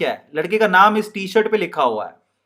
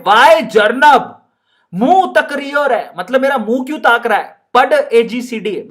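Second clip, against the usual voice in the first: Rajasthani scolding madam; 4 semitones higher, 3 dB louder than usual. Acted. Teacher voices the madam scolding Jarnab in Rajasthani. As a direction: scold the boy in a Rajasthani accent. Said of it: "madam कहती है".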